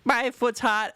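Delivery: very high, whiny dog voice. Retold or imitated. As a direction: yelp it like a startled dog. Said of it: "Then she's like".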